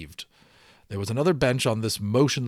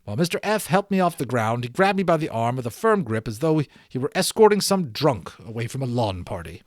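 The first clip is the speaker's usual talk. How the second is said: usual speaking voice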